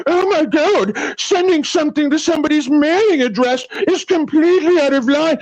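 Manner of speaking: high, prissy mockery of the other side; mock-offended whine; mocking whiny voice. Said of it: "make a ridiculous fucking argument".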